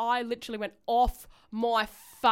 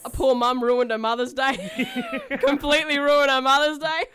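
laughing through guilt; 3 semitones higher, 4 dB louder than usual. Real, not acted. Guilty laughter over ruined day.

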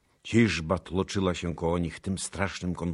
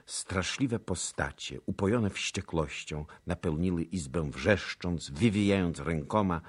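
ordinary delivery

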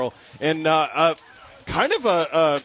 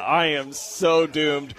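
ordinary talk

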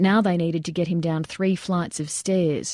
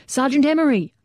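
high, urgent call of a name; louder yell; 8 semitones higher, 4 dB louder than usual. A narrator's reading. Soldier calling to his fallen sergeant, louder high yell. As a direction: cry out the name, pitched well up. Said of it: "then louder".

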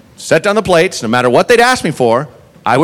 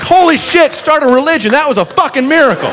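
much higher, excited mock awe; awestruck; 9 semitones higher than usual. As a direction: rave like you witnessed a miracle. Chasing him in awe.

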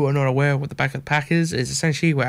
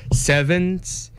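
vampire voice; 5 semitones higher than usual. Pretend. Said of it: "What do spooky Halloween people say?".

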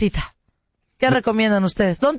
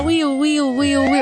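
imitated siren; 3 semitones higher, slower than usual.

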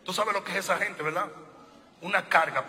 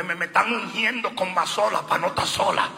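normal talking voice

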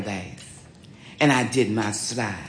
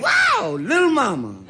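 excited shout. Retold or imitated. [name] reacting to her slide, an excited high shout. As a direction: shout it out, knocked back by her dance.

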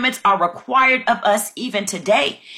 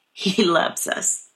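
slow whisper, much softer; whispering the repeat to the congregation; hushed whisper; 3 semitones lower, 4 dB quieter, slower than usual. Real, not acted. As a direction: breathe it out slowly and tenderly. Repeats God's love, softening.

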